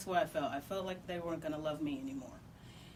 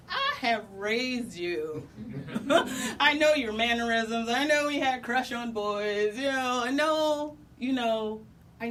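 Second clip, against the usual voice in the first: louder, quoting dad while laughing; laughing through quote; 4 semitones higher, 7 dB louder than usual. Retold or imitated. Quoting his dad's loving words while laughing. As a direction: keep the laugh in the voice as you quote him.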